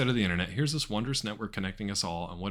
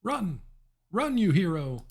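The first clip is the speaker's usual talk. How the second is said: mock shout; 11 semitones higher, 6 dB louder, slower than usual. Urging game character to flee.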